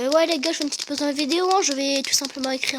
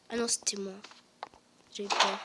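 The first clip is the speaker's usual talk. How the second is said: whispering; 4 semitones lower, 5 dB quieter than usual. Real, not acted. Relief after hearing footsteps.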